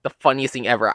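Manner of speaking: ordinary delivery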